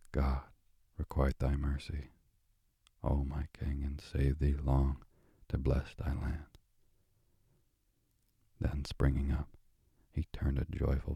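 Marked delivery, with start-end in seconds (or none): none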